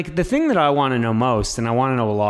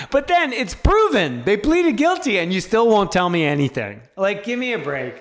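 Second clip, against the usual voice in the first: indignant outrage; 6 semitones higher than usual. Real, not acted. Outraged complaint about agency.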